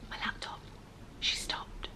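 breathy whisper. Real, not acted. Noticing her laptop stopped, a real whisper. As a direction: hush it off-mic.

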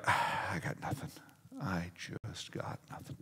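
quiet, breath-heavy admission of being lost; mock defeat. Pastor admitting he is lost among younger staff, defeated. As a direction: let it deflate almost to a whisper.

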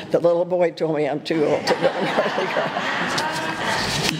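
chuckling through the words; laughing. Real, not acted. Preacher joking to congregation about being too old, laughing. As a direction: laugh while telling the joke on yourself.

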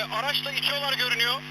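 ordinary talk, nothing marked

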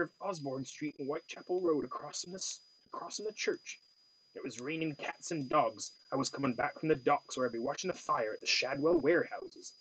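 wistful Cornwall lilt, remembering a friend; Cornwall accent. Acted. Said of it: "a Cornwall accent".

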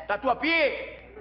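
slow, drawn-out proclamation; grand listing. Acted. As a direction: announce it like a famous city. Remata la lista de ciudades. (proclamado con grandilocuencia)

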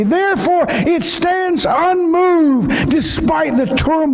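full-force preaching. Acted. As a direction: preach it with fire to the church.

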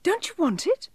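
very high, disbelieving elf question; startled little elf; astonished cry. A narrator's reading. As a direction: ask it amazed, in a tiny high voice. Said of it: "cried the elf".

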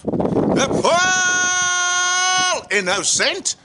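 "Paul" held long and high; grand title announcement. Acted. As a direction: announce the show, stretching out "Paul".

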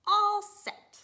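high, loud all set; eager shout. Acted. Lucy answers the starter with an eager loud shout. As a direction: call it out, ready to go. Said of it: "Lucy answers".

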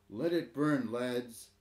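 put-on old headman calling out firmly; commanding. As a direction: order them to stand back and let it burn. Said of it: "he commanded".

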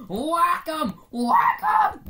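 high gleeful yells; excited shouting. Real, not acted. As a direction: yell it with glee. Hacking at zombies.

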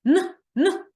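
teacher repeating the consonant; sound demo. Shown as a demonstration. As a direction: sound out the hard "Н" clearly. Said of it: "Тому що звук Н".

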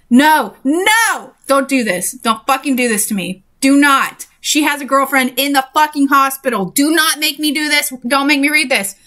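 high, pleading outburst at the book; exasperated protest. Real, not acted. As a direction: protest in horror, pitch jumping up. Reader begs book not to continue.

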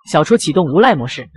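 usual speaking voice

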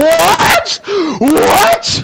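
high-pitched, yelped question; shocked disbelief. Real, not acted. Player baffled by a sudden hit, high shocked yelp. Shocked by what happened in game.

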